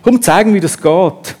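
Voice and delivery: woman mocking Jesus. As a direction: taunt him, come on show me. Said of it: "Sie verspottet da Jesus weiterhin".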